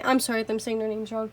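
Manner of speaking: normal talking voice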